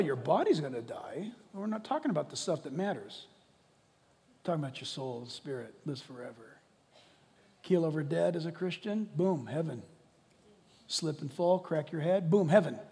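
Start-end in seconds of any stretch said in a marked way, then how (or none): none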